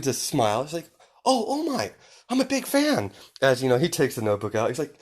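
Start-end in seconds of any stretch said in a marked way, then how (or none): 1.2-3.1 very high fanboy gush; starstruck fan. Acted. Izuku smiling at his hero, high-pitched and starstruck. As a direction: gush at your idol in a high voice.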